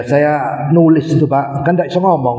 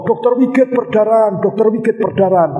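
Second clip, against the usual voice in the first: retold nurse yelling; 7 semitones higher than usual. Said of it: "Perawatnya bengok-bengok".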